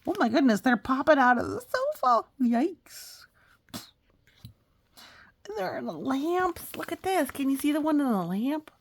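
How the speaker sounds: high, loud thrilled reading; loud, bright squeals of surprise; excited. Real, not acted. Cats popping out everywhere.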